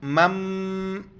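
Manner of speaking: मम held with the sound hummed through the nose; nasal ghunna. Shown as a demonstration. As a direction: hum the doubled meem मम nasally. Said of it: "नाक में आवाज़ छुपाएंगे".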